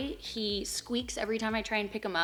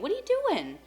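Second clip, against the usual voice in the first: playful high-pitched scold to a rat; high pet scolding; 12 semitones higher, 4 dB louder, faster than usual. Real, not acted. Owner scolds a rat causing fights, high pet talk. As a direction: ask the rat, high and teasing.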